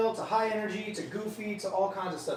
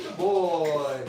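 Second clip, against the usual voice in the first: ordinary talk, nothing marked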